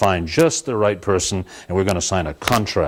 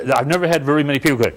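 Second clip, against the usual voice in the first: ordinary talk, nothing marked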